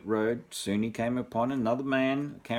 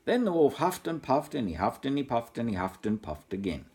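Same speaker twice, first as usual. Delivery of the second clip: ordinary delivery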